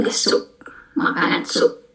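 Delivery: whispered gloss, no voice. Shown as a demonstration. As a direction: softly breathe the meaning. Teacher whispers translation aside.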